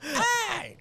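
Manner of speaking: very high-pitched comic squeal; Chris Tucker. Acted. Host tries the requested comedian impression, high-pitched. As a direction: attempt a squeaky celebrity impression. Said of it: "I wanted you to do your Chris Tucker".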